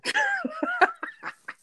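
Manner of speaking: big high-pitched laugh bursting out; long laugh. Real, not acted. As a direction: crack up laughing. Laughing at his own Friday.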